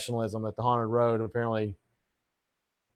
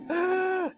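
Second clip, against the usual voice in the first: sharp gasp. Acted. Sharp gasp mid-sentence.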